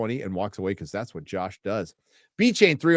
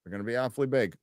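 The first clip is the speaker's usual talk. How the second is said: softer echo of the quip, "big" falling; quiet repeat; 2 semitones lower, 8 dB quieter, faster than usual. Real, not acted. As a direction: mutter the joke again to yourself. Repeats the joke to himself.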